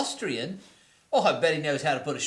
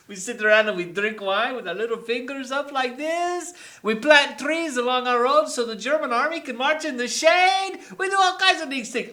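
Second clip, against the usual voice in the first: mock Frenchman; 8 semitones higher, 8 dB louder than usual. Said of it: "I am the Frenchman".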